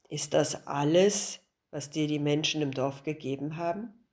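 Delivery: put-on a lightly deepened wise old man, puzzled; old master. (tiefere Stimme des alten Meisters, verwundert)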